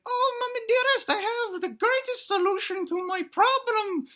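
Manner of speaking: put-on high, squeaky, theatrical child; little dinosaur son. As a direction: squeal it grandly to Mama. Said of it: "he tells his mom, he's like".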